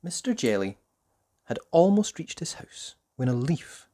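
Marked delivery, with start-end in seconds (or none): none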